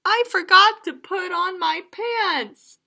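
sheepish confession; a silly little frog voice; embarrassed Froggy. Acted.

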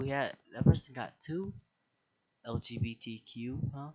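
ordinary delivery

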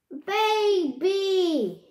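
high, loud jeering shout; taunting call. Acted. Boy calling out to mock the girl stomping off, loud. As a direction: yell it after her to mock. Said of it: "Max called".

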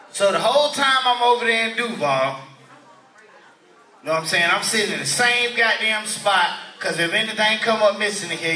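ordinary talk, nothing marked

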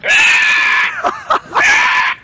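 piercing high raptor screech; dinosaur voice. Pretend. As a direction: let out a raptor scream. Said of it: "Do it again, do it again".

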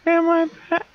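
squeaky falsetto try that cracks off; high girl voice attempt. Shown as a demonstration. Trying a high voice for the game girl. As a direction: reach for a very high voice and fail. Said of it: "no, I can't do it".

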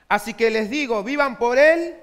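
preaching the verse out loud; loud scripture reading. Real, not acted. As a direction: proclaim the verse at full force. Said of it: "reading scripture at force".

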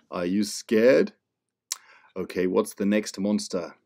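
ordinary delivery

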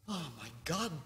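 ordinary talk, nothing marked

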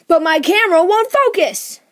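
sudden high, very loud outburst; exasperated shout. Real, not acted. Kid interrupting the comic to blame the camera, exasperated shout. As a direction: burst out yelling in frustration.

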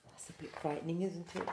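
plain reading voice

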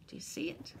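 unvoiced, hushed question; whisper. A narrator's reading. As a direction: whisper it to the children. Reading aloud to children.

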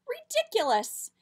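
high loud scoff; exasperated. A narrator's reading. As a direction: throw it up, outraged. Child scoffs at dad's advice.

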